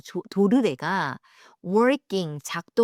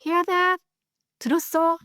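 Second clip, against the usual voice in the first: excited little prince; 9 semitones higher, slower than usual. Acted. Little prince's line acted.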